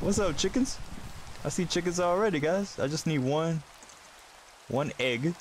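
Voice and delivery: high, bright greeting to chickens; high excited greeting. Pretend. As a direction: sing out a greeting to pets.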